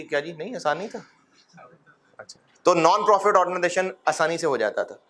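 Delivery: usual speaking voice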